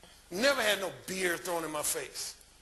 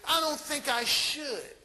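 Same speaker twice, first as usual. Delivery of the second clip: higher, slow and drawn-out reluctance; imitated woman wavering; 6 semitones higher, slower than usual. Woman voicing her social programming, slow imitated reluctance. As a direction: stretch it out as a mock-torn woman. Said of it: "her social programming is saying".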